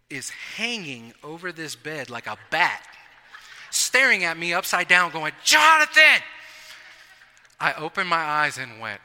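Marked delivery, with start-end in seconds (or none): 5.4-6.2 high, loud shout of a name. Retold or imitated. Casey on the top bunk waking his roommate with a shout. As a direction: yell to wake him.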